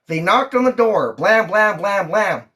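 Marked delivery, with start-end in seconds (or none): none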